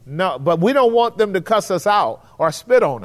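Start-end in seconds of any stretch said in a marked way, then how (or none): none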